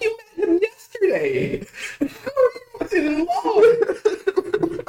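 high-pitched squeal breaking into laughter; laughing disbelief. Retold or imitated. Mocking a heroine who fell in love overnight, laughing disbelief. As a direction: crack up in comic outrage, drawing out the question. Laughing incredulously at instant movie romance.

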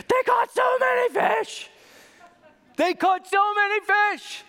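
very high, loud, slow shout of they caught so many fish; excited shout. Shown as a demonstration. Preacher showing how the story should sound, excited shout. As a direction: yell it in wild amazement. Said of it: "You have to say".

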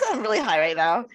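giggly, through laughter; laughing. Real, not acted. Admitting to co-host she's high, laughing. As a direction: say it between fits of laughter.

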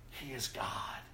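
breathy hushed whisper; a soft unvoiced whisper of awe. Real, not acted. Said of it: "whispered repetition".

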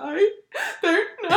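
high, long drawn-out comic wail; mock crying. Crying woman answering her friend, mock wail. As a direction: imitate a helpless sob, half laughing. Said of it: "I was literally just crying".

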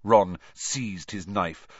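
ordinary delivery

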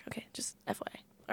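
whispered aside; quick whispered side note. Real, not acted. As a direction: drop to a confiding whisper. Aside after cultural confession.

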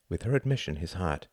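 ordinary delivery, nothing marked

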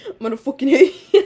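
sudden loud deadpan threat, laughing; mock threat. Retelling her sudden threat to her friend, loud. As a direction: snap it out after long silence. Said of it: "And then I just go".

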